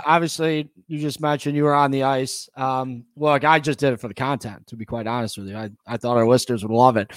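ordinary talk

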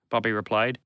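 ordinary delivery, nothing marked

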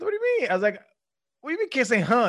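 high, loud, incredulous squeal; disbelief. Retold or imitated. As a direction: sound stunned that this could be a rule. Incredulous reaction to coach.

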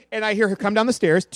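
ordinary talk, nothing marked

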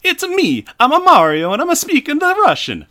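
high, loud Mario-style Italian accent; absurdly hammed-up Italian accent; generic mock-Italian accent. Shown as a demonstration. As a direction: belt it in a cartoon Italian accent. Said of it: "do you wanna hear my generic, uh, accent".

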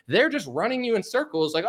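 ordinary talk

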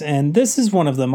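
usual speaking voice

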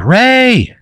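very high, put-on woman's call. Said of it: "a woman's voice".

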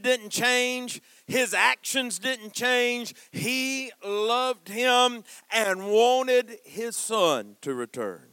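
high, loud, slow, drawn-out preaching; full-force sermon climax. Real, not acted. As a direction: preach it to the rafters.

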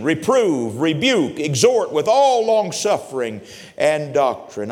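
loud, rising sermon cadence; preaching. Acted. Preacher charging the young believers, full force. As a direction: thunder the scripture to the congregation.

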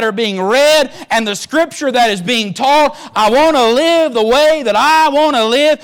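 high, loud, slow defiant demon voice. Acted. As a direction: let the stubborn devil refuse to change. Preacher voicing the devil.

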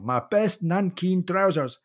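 hammed-up Scottish outrage over ruined trousers; Scottish accent. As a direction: savour a broad Scottish accent, indignant and slow. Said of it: "this Scottish accent just enough that you can really hear this indignant, outburst".

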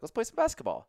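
high mock voice of an eager friend; high mock Paul Rudd. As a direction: play the upbeat invite high. Paul Rudd voiced in retold scene.

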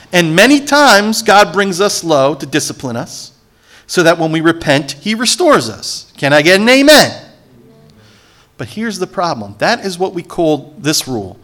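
ordinary talk, nothing marked

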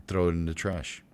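ordinary talk, nothing marked

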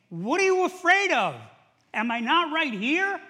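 loud, high exasperated challenge; annoyed rebuke. Acted. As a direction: scold them at full voice, exasperated. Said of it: "when he gets annoyed at you and saying".

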